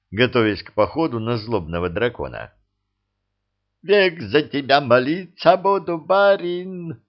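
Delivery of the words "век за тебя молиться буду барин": high loud wail of gratitude. A narrator's reading. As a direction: cry it out, overjoyed. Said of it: "завопил Никишка".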